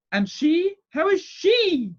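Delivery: very high, loud, insistent question; sharp. A narrator's reading. Aunt pressing the doctor about the newborn, sharply. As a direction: demand an answer, stretching the words. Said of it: "said my aunt sharply".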